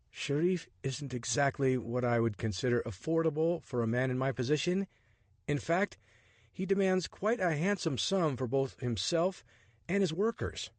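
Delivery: a hint of a startled man grumbling; Richard, exasperated. Richard's dialogue voiced.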